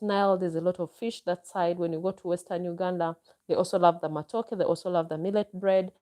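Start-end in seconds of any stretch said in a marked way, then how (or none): none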